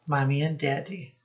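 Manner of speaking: usual speaking voice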